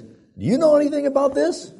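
very high, loud retold interrogation; mock angry demand. Dad points at the mess, mock outraged demand. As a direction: confront the culprit, voice shooting up.

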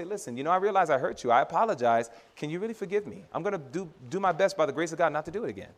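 soft, contrite apology; spirit of sorrow. Shown as a demonstration. Showing how to apologize to someone hurt, sorrowful. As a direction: apologize quietly and sincerely, asking forgiveness. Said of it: "with a spirit of sorrow".

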